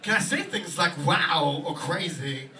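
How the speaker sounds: very slow, loud, halting Walken; Christopher Walken impression. Walken asking his agent about words, slow and halting. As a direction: space the words strangely. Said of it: "It's just like".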